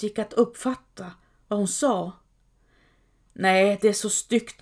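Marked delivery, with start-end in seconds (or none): none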